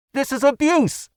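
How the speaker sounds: high, shrill-toned woman's protest; indignant protest. A narrator's reading. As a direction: cry out in outrage. Mouse protests from the bundle.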